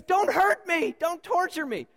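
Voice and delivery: high, loud frightened plea; acted terrified scream. Madman kneeling before Jesus, terrified. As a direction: cry out in hammed-up terror. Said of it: "he screams out terrified".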